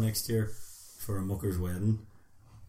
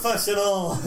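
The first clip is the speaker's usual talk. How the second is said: mock praise; 11 semitones higher, 4 dB louder than usual. Mock praise after recording scare.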